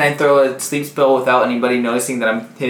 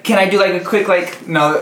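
normal talking voice

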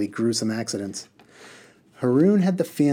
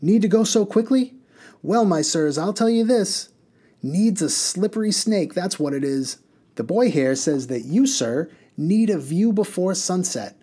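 boisterous bus driver; 8 semitones higher than usual. A narrator's reading. Mister Butt lecturing his passengers while speeding. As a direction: play the cocky driver holding forth.

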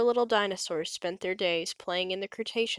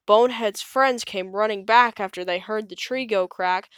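ordinary delivery

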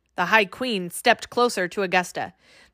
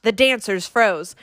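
ordinary delivery, nothing marked

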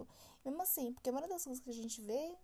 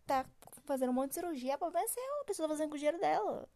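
high, louder, exasperated outburst; indignant retort; 5 semitones higher, 5 dB louder than usual. Acted. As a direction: fire back at nosy critics, pitch jumping up. Ranting back at online critics.

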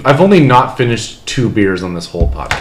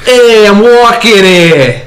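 put-on slow, higher, louder New York cabbie accent; 10 semitones higher, 7 dB louder, slower than usual. Host doing a New York accent on request, slow, higher and louder. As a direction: bark it like a New Yorker stepping into traffic. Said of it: "Can you do it in a New York accent now?".

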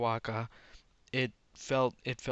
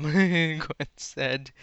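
loud, higher tag line through a laugh; bursting into laughter; 4 semitones higher, 10 dB louder than usual. Real, not acted. Reader after Gwen's stammered line, bursting out laughing. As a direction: crack up while finishing the line.

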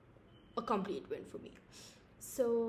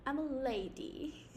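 ordinary talk, nothing marked

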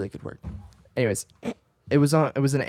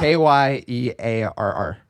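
slow spelled out; 3 dB louder, slower than usual. Shown as a demonstration. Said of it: "how would you spell that?".

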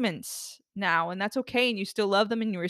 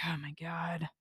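regretful groan. Retold or imitated. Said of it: "You're like".

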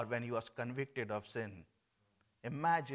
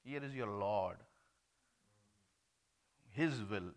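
ordinary talk

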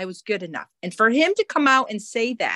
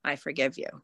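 softly repeated, much softer; quiet forgiving mantra; 5 semitones lower, 10 dB quieter, faster than usual. Retold or imitated. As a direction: murmur it to yourself while driving past. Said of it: "I would say".

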